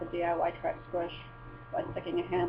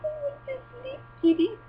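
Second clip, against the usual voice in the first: high pleading coo. Retold or imitated. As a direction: coo at the cat to settle down. Pleading with the cat at night.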